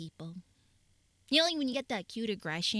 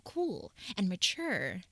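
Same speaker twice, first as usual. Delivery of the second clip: ordinary talk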